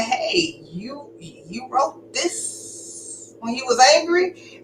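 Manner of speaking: high, soft and slow, disbelieving; stunned question. Retold or imitated. Wife confronting her husband about his hate letter, soft disbelief. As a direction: ask it quietly, as if you can't believe it.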